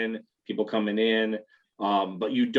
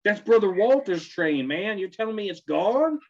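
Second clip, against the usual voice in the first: high bro voice; 10 semitones higher than usual. Acted. GM voices Chad.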